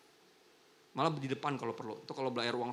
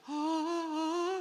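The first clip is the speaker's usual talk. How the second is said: high gasp demo; 4 dB louder than usual. Shown as a demonstration. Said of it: "bukan suara".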